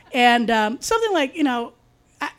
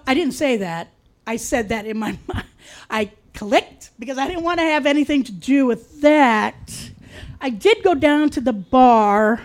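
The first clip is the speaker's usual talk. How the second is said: ordinary talk, nothing marked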